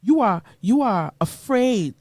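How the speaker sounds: ordinary delivery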